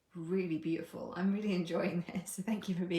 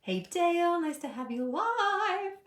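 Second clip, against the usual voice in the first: exaggerated high-pitched greeting; playful greeting; 11 semitones higher, 4 dB louder than usual. Real, not acted. Greets a viewer joining live.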